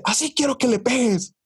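high-pitched mock-angry mom; imitating scolding mom. Acts out mother scolding father.

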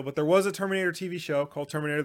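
ordinary delivery

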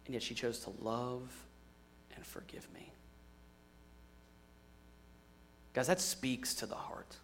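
real emotion, dropping to a whisper; barely holding it together; husband whispering through real emotion about his wife; choked-up whisper. Real, not acted. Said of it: "try to do this without getting emotional".

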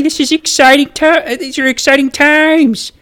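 high-pitched excited hype; thrilled fan talking up the big game. Acted. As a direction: gush with excitement, voice lifted high. Hyping up the team's Super Bowl trip.

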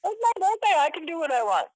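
high, loud playful defiance. Real, not acted. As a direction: brag it with a grin.

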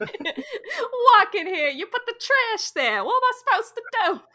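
high, exasperated mock Italian American; hammed-up Italian-American griping; Italian New Yorker. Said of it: "We need an Italian New Yorker".

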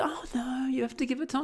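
high mock wife soothing him; imitating wife. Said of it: "wife quoted again".

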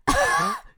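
loud unvoiced snort laugh; honking laugh. Shown as a demonstration. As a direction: imitate your own ugly laugh. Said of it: "I'm, like, literally going like".

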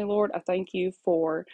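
ordinary talk

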